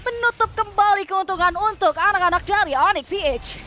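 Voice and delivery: loud, fast esports call; excited play-by-play. Real, not acted. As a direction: shout the teamfight as it happens. Live play-by-play of teamfight. (komentar heboh)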